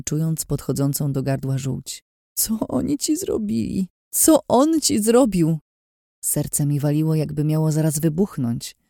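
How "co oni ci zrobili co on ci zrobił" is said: horrified. Acted. Daughter seeing her beaten father, high anguished horrified cry. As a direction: pour out horror at his wounds.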